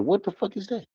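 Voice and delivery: high, hushed mock disbelief; puzzled reply in a small high voice; baffled retort. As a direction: act out quiet, high-pitched bafflement. Acts out own baffled reply.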